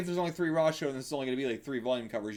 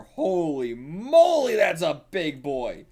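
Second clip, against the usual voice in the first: amazed; 4 semitones higher, 8 dB louder, slower than usual. Real, not acted. Sees big panther.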